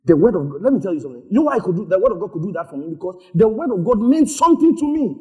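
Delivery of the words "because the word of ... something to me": usual speaking voice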